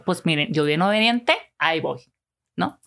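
ordinary talk

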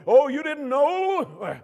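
high, drawn-out full yell. Acted. Believer shouting down the mountain, over-the-top yell. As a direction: holler it slowly at the top of your range. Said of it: "I start yelling louder at the mountain".

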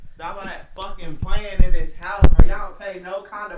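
loud blown-out yell; clipping distorted mic. Real, not acted. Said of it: "stop yelling in the mic".

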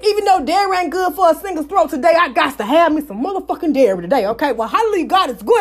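usual speaking voice

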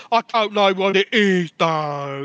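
mocking a dim celebrity, loud and high; hammed-up clueless contestant voice; dumb reality star. Mocking reality show contestants, dim loud voice. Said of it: "people who've, like, made loads of money".

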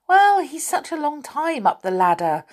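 high, whiny complaint; complaining. A narrator's reading. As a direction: moan about the wait. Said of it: "complained Beth".

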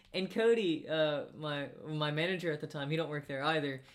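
ordinary talk

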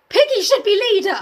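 high, loud championing cry; loud, high mock rallying call; rallying shout. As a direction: shout it like a campaign slogan. Campaigning shout for a book character.

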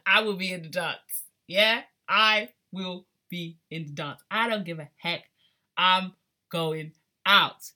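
loud, punched-out vow; emphatic declaration. Real, not acted. Club fan vowing to go dancing, defiant. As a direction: declare it loudly, hammering the words.

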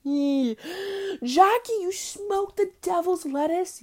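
high, slow pearl-clutching voice; mock scandalized prude. A judgy person gasps at her weed habit, high and slow. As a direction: play a scandalized square. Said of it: "weed is frowned upon".

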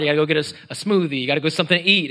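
usual speaking voice

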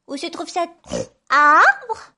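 high piglet voice, leaping up at the end; curious little pig. A narrator's reading. Little pig to the wolf about the tree, curious. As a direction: ask with a squealed last word.

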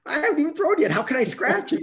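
high, loud incredulous protest; disbelief. Retold or imitated. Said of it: "And I'm like".

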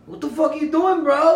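incredulous. Retold or imitated. Retelling shock at friend's beer.

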